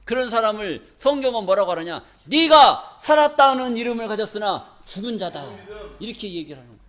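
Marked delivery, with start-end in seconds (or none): 2.3-5.4 declaimed scripture. Acted. Preacher declaims the verse.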